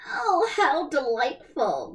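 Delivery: slow, affected gushing; delighted stepsisters. A narrator's reading. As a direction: gush over the invitation, put on airs. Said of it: "they said to each other".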